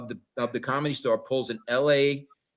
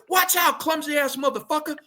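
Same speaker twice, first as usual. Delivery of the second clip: high yelled warning, much higher; mock angry shout; 12 semitones higher than usual. Rapper shouting at Jimmy for spilling drinks, high mock yell. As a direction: bark it like an offended rapper. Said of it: "They go".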